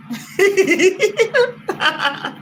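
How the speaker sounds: high falling laughter. Real, not acted. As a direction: let a high laugh tumble down. Laughing at goat voice.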